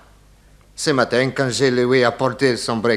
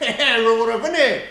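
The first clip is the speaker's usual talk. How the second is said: excitable Italian servant; 8 semitones higher than usual. Acted. Italian servant greets detective.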